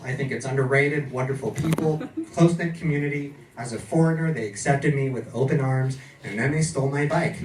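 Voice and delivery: normal talking voice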